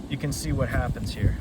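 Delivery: ordinary delivery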